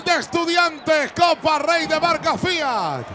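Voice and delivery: stretched, high goal shout; goal call. Real, not acted. Commentator to TV viewers on the goal, long excited shout. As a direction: call the goal to the whole country.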